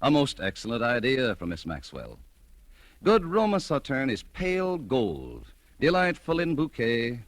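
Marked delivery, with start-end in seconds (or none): none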